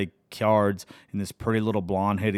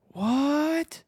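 squeaky, very high, stunned "what?". Real, not acted. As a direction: jump way up in pitch, utterly floored. Stunned reaction to song lyrics.

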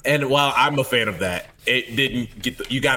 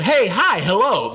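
much higher, louder mock greeting; mocking gushy hello; 8 semitones higher, 4 dB louder, slower than usual. [name] mocking Jason's over-eager hello to the barista. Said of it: "You said".

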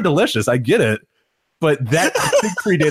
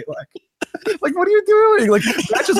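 incredulous; slower than usual. Real, not acted. Disbelief at Vampiro's Twitter fights.

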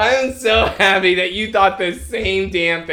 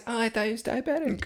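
mock innocent; 6 semitones higher, 7 dB quieter, faster than usual. Mocking Lana's excuse.

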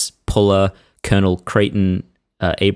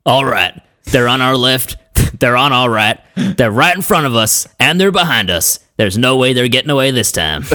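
higher, louder cocky general, laughing through; gung-ho general quote; 6 semitones higher, 4 dB louder than usual. Acted. Reading a general's famous quote, higher and louder with laughs. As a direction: bark it like a swaggering general. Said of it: "So I'll read the two variations".

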